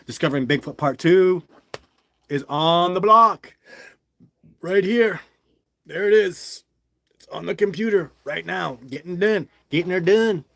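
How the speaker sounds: loud, high Southern holler; Southern accent. Shown as a demonstration. Vlogger hyping his new film to viewers, loud put-on Southern accent. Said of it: "Just doing my Southern accent".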